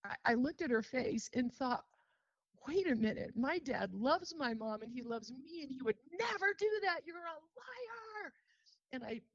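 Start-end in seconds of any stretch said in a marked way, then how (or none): 2.7-8.3 put-on a stunned little girl refusing the truth; disbelieving child.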